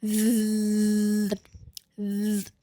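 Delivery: soft long buzzing zzz, fading out; bee buzz. Shown as a demonstration. Teacher demonstrates buzzing for the voiced th. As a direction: keep buzzing and feel it vibrate. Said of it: "see the vibration".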